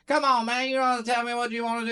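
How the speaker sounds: high, rapid-fire Southern patter; unintelligible Southern accent. Acted. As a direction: rattle it off as a fast-talking Southerner. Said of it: "non-mutually intelligible Southern accents".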